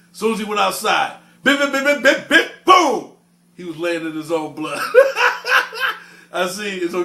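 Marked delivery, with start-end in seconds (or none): 1.4-3.1 beating sound effects. Retold or imitated. 4.9-6.0 real high-pitched laugh; sudden real cackle of delight; high burst of laughter. Real, not acted. Laughs at the beating story.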